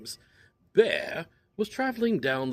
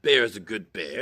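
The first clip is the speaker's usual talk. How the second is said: ordinary delivery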